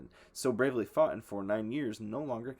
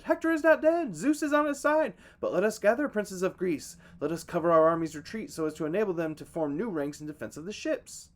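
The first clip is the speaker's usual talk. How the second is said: high, loud rallying shout; 9 semitones higher, 8 dB louder than usual. A narrator's reading. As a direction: cry out to gather the princes. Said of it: "who shouted".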